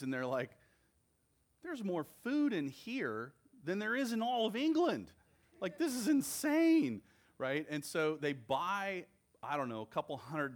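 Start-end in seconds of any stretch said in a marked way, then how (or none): 1.6-7.0 much higher, louder, amazed British tourist. Retold or imitated. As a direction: gush over the food like a stunned visitor. Brits amazed inside Buc-ee's.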